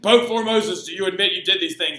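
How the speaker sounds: lightly put-on interrogator voice; mock prosecutor. Pope Stephen questioning dead Formosus, mock-stern. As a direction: grill the corpse like a judge. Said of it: "it would be like".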